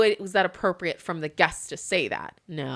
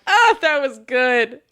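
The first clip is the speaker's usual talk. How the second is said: laughing through it, slower and louder; savoring the story between laughs; real laughter while quoting herself; 6 semitones higher, 9 dB louder, much slower than usual. Real, not acted. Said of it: "And I am laughing".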